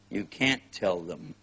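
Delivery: ordinary talk, nothing marked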